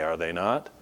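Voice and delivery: usual speaking voice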